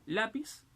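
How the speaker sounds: lápiz said LÁ-piz; stress on first syllable. Shown as a demonstration. Teacher showing palabras llanas, lápiz stressed on LÁ. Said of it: "la mayor fuerza de voz es".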